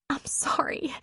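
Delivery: a soft, ashamed whispered apology. A narrator's reading. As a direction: breathe it out, barely voiced. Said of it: "she whispered".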